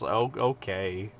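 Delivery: normal talking voice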